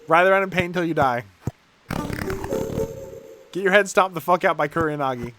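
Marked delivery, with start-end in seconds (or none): none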